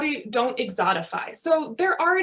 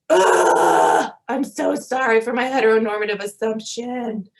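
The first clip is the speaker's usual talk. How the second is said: hammed-up groan into an exaggerated apology; mock mortified apology; 6 dB louder than usual. Retelling her flustered apology to the colleague, hammed-up groaning. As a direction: play up the embarrassed sorry. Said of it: "And then I was like".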